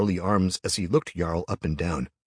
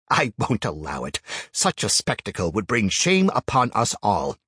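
stern elder; 3 semitones higher, 5 dB louder than usual. A narrator's reading. Elder scoffs and refuses.

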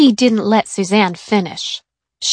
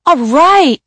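put-on higher, louder excited boy shout; 8 semitones higher, 8 dB louder than usual. A narrator's reading. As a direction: cheer it out loud. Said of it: "shouted Kevin".

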